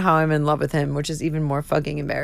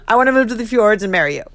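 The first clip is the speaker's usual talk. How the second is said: fast, louder gush with laughter; giddy mock confession; 3 semitones higher, 6 dB louder, faster than usual. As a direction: blurt it out, half laughing. Joking gushing confession.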